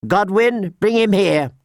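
high-pitched order to a guard; commanding Septa. A narrator's reading. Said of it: "the Septa said".

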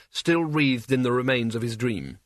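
plain reading voice

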